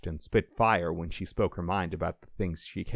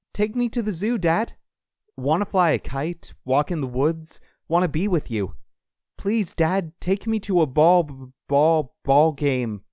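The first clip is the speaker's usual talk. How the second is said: put-on boy's voice fading; 8 semitones higher, 6 dB louder than usual. A narrator's reading. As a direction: start high and loud, sink steadily as if receding. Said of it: "The boy's voice grew faint".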